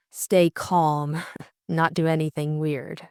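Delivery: usual speaking voice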